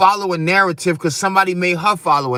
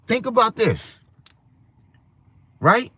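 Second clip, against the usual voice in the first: usual speaking voice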